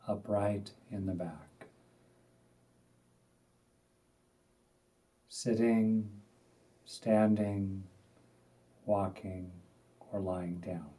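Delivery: unhurried, spacious delivery, letting each instruction settle; guided meditation. Acted. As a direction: guide them gently into stillness, phrase by phrase.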